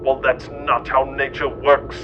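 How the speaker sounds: normal talking voice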